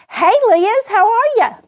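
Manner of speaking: high, cheerful hello; excited greeting. Real, not acted. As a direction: call out a happy greeting. Greeting a viewer by name.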